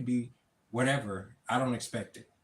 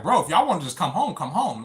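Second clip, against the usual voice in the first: dad's voice, higher, louder and fast; imitating dad; 6 semitones higher, 6 dB louder than usual. Dad telling his son to just come home, imitated higher and louder. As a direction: pitch it up and push it out like dad inviting him home. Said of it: "him just saying".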